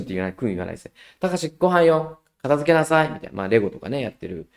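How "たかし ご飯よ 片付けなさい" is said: calling to dinner. Retold or imitated. Mother calling son to dinner.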